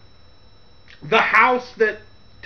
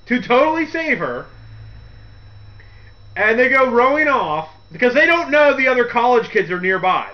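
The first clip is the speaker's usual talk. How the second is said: normal talking voice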